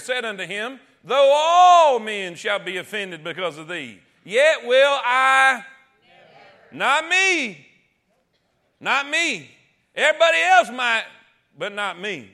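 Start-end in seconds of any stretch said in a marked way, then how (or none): 1.0-5.6 loud, slow, defiant vow; loud slow bragging Peter; Peter's loud boast. Acted. 6.7-12.3 not me. Acted. Preacher voicing Peter's boast.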